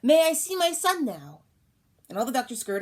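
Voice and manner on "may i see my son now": high, loud call across the room; queen calling loudly from her bed. Acted. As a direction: call out to be heard. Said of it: "Buttercup called out".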